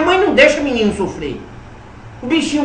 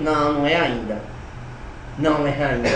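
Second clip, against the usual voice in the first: lower, softer spirit voice saying not yet; a quiet, lowered no from the beyond; soft, low voice of kindly spirits holding him back; gentle refusal; 7 semitones lower, 7 dB quieter than usual. Retold or imitated. Spirit family refusing the child.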